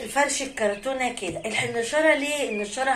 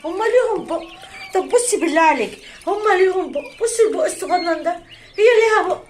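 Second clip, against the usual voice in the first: high, loud, emphatic exclaiming; animated exclamation; 6 semitones higher, 6 dB louder than usual. Real, not acted. Exclaiming to viewer over the birds' tiny mouths, animated. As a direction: exclaim it with pitch jumping up.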